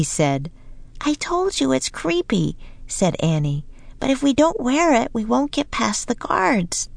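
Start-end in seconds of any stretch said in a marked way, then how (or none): 1.0-2.6 put-on little girl voice. A narrator's reading. Annie voiced by narrator. 4.0-6.9 put-on high, light little-girl voice, urging; higher child voice, coaxing her brother; young girl Annie. A narrator's reading. Narrator voices Annie throughout.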